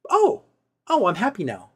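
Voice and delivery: high, saccharine switch to happiness; mock sickly sweet. Mocking the girlfriend's sudden sweet turn, high and syrupy. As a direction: flip suddenly into syrupy cheer.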